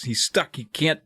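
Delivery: ordinary delivery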